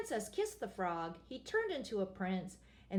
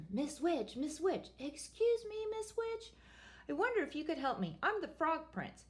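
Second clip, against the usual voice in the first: nervous prince; 6 semitones higher than usual. Acted. Prince addresses third witch.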